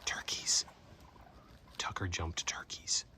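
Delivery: slow, soft whisper; hushed whisper. Real, not acted. Hiker whispering that his dog flushed turkeys, hushed whisper. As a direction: whisper it so the birds don't hear.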